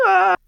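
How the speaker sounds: sharp, high loud yelp; impaled scream. Acted. Thug screams as he hits the spiked fence. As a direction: cut it off as he lands.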